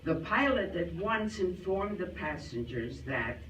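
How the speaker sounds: usual speaking voice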